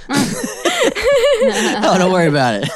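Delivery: put-on giggly kid voice. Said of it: "voicing Kate as a child".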